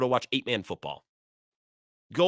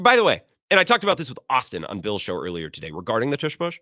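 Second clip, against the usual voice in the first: usual speaking voice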